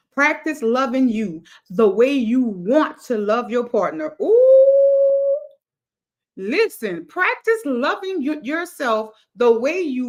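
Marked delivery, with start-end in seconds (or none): 4.2-5.5 high, loud excited ooh; a sudden thrill in the voice. Real, not acted. Live host thrilled by her own words, high ooh.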